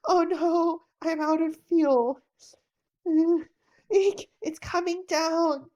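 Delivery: high, loud alarm as the plane drops; rising panic in the cockpit; panicked. Acted. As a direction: yelp in fright. Plane running out of fuel.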